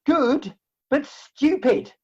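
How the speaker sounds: mocking put-down; scornful verdict. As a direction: scold her with contempt. Insulting the fairy.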